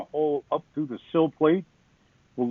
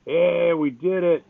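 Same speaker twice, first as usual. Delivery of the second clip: mock victory cheer, higher and drawn out; retold happy yell; 7 semitones higher, 3 dB louder than usual. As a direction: imitate yourself yelling happily, without full volume. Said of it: "I was all happy, yelling".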